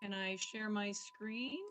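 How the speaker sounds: faint, slow, distant mic audio; very quiet online call voice; barely audible. Real, not acted. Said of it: "I can barely hear you".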